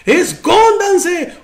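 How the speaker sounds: high, panicked cry of a ghost sighting; frightened high yell, the last word drawn out; scared shout. Acted. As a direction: shriek it like terrified fishermen seeing a ghost. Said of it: "dice que gritaron".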